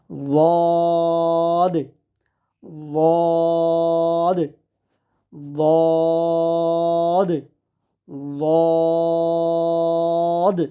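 বদ with soft onset and long rounded vowel; soft heavy বদ. Shown as a demonstration. Teacher showing it apart from দাল, বদ soft-onset and stretched. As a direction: keep the tongue tip loose, hold বদ level. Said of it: "নরম করে উচ্চারণ করেন".

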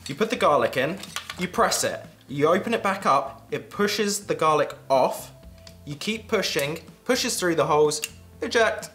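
usual speaking voice

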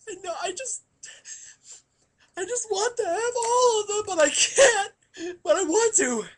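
high, wailing mock sob; comic sobbing. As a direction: blubber it out, hammed-up crying. Comic crying about bats.

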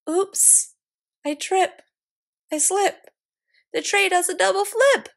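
rising alarm as the tray tumbles; mounting mishap. Acted. Nancy's tray disaster.

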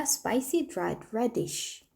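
spicy, dried, reddish said slowly and clearly; model pronunciation. Shown as a demonstration. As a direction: drill the words for learners to repeat. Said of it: "발음해볼까요?".

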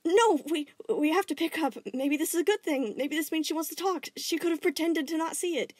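high, loud hopeful urging; eager gem voice. Acted. Gem urges him to answer her call, high and pleading.

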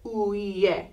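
uye with every vowel drawn out; three separate vowels in uye; all vowels sounded. Shown as a demonstration. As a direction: say uye slowly, each vowel pronounced separately. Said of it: "you're not having to say uye".

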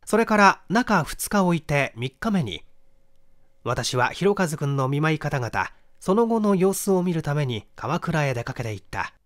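usual speaking voice

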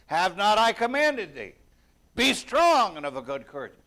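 loud, high divine command. Acted. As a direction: thunder it down as God. God's command, read forcefully.

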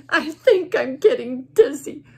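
loud bird crying he's getting dizzy. Acted. Bird wails to his friends, loud and crying. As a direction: wail it out, sobbing and loud. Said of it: "Bird cried louder".

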